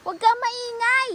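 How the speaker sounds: hammed-up scolding of the sea; mock scolding. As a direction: tell off the noisy sea like a child. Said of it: "Pagalitan ko nga".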